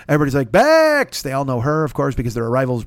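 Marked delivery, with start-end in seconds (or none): 0.5-1.2 1 calling out. Retold or imitated. Ushers greet Bex by name.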